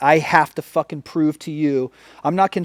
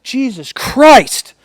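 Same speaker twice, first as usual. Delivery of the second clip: slow, higher and much louder stunned cop voice; startled officer exclaiming at the suspect; imitating the cop; 9 semitones higher, 9 dB louder, much slower than usual. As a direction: drag out the stunned cop's words, pitched up and loud. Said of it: "He was just like".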